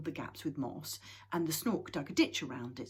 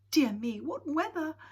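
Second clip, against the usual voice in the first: startled; 11 semitones higher, 10 dB louder than usual. Acted. Moomin Mama startled by storm.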